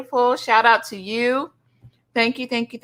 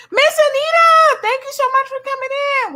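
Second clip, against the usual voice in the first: high, loud, sing-song greeting; excited welcome; 4 dB louder than usual. Real, not acted. Streamer squealing a welcome to Miss Anita. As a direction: call her name up high with delight.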